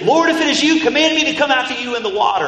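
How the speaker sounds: high, loud, urgent call. Retold or imitated. Peter calling to Jesus on the water, calling out.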